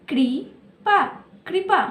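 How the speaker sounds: ordinary delivery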